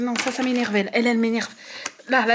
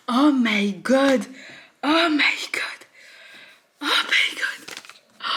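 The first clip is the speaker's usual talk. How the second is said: breathless disbelief; 2 semitones higher, much slower than usual. Real, not acted. Overwhelmed after finishing.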